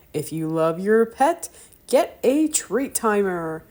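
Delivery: big hammed-up ad pitch; TV announcer. Announcer pitching the Treat Timer to viewers, hammed up. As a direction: sell it like a TV commercial.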